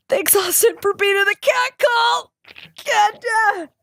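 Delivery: real tearful cry-laughing; crying thanks. Real, not acted. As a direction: sob out the thanks while laughing. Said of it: "We're all crying with you".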